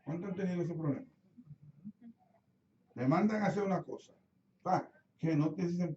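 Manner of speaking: ordinary delivery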